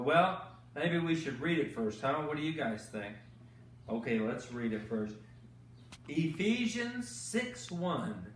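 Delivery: ordinary delivery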